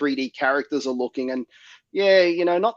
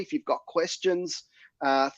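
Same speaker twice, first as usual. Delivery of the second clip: ordinary talk, nothing marked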